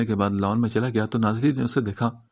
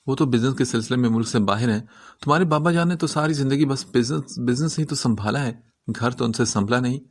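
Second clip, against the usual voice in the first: ordinary delivery, nothing marked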